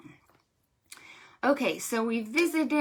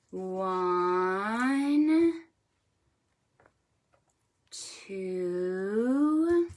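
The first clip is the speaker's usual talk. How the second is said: building count; 5 semitones higher, much slower than usual. Shown as a demonstration. Librarian counting hippos aloud with children. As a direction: count slowly, rising to a big five. Slow dramatic count with kids.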